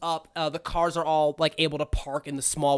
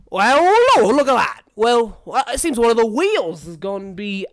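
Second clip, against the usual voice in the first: loud, high exclamation easing into appraisal; startled frog chauffeur; 4 semitones higher, 9 dB louder than usual. Acted. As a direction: exclaim like a servant spotting trouble. Chauffeur notices a bad wheel.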